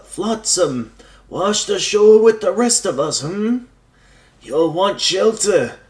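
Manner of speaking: put-on old man voice. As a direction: voice a very old hermit on the beach. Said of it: "did I give this guy the old man voice?".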